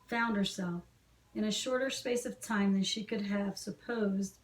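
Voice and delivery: ordinary delivery, nothing marked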